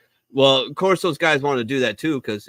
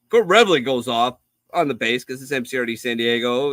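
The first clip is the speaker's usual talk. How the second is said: ordinary talk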